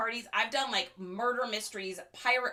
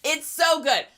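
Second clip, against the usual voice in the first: louder, higher and emphatic; excited; 5 semitones higher, 7 dB louder than usual. Real, not acted. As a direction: say it with more delight. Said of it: "repeated louder".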